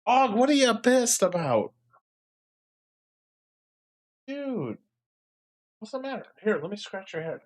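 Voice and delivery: high coaxing voice for the parrot; pet talk. Real, not acted. Coos at angry pet parrot.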